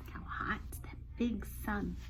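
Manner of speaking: whispered aside. Real, not acted. Aside about the sun.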